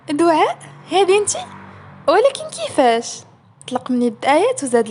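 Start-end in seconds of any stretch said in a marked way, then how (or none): 0.1-3.2 مفاجأة؛ مستغرب بزاف، صوت عالي وطالع لفوق؛ كأنه ما صدقش اللي شاف، صوت مرتفع وعالي؛ عروة كيتفاجأ ملي كيشوف دعاء، صوت عالي ومرتفع. A narrator's reading.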